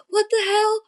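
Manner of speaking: high, startled mock yell from down the hall; retold yell. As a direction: imitate the coach yelling "What the hell?". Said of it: "yell across the hallway".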